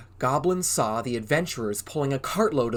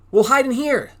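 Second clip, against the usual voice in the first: urgent; 11 semitones higher, 10 dB louder than usual. A narrator's reading. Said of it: "said Goblin".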